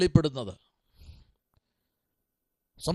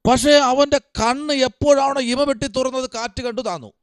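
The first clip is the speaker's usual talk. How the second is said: high-pitched, animated sermon retelling; preacher's raised, urgent pitch; impassioned preaching; 7 semitones higher than usual. Real, not acted. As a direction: drive the point home to the congregation. Preaches Peter on the water.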